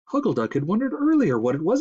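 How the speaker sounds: usual speaking voice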